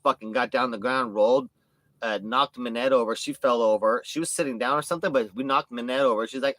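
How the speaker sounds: usual speaking voice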